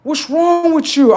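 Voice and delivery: very high, slow and loud voice; high-pitched girlfriend. Retold or imitated. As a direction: squeal the question like a startled ex. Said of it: "she like".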